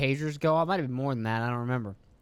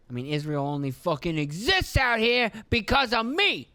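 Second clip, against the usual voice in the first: raised, emphatic outburst as the aggrieved patron; acted self-important protest; indignant American boast; 6 semitones higher than usual. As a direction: push it high and forceful, like an affronted benefactor. Voices America boasting about Israel.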